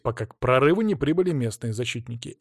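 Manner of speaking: ordinary delivery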